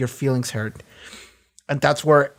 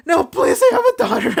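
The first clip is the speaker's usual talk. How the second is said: very high, frantic begging with laughter in it; mock pleading; 4 dB louder than usual. Retelling Mesmer begging Butcher for his life, mock pleading. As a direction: beg for mercy in a hammed-up squeal.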